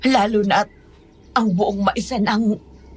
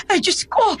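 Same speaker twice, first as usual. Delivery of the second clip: high-pitched startled exclamation; oh-my-God fright, voice jumping up; alarmed; 9 semitones higher than usual. Acted. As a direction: gasp it out in alarm. Startled by trouble at the house.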